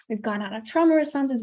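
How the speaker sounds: ordinary talk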